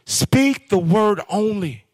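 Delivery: each word spaced out, slow and firm; slow emphatic statement. Shown as a demonstration. Preacher stressing the centurion's statement, slow and firm. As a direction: lay the phrase down slowly like a decree. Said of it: "He made a statement".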